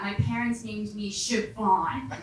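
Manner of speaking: usual speaking voice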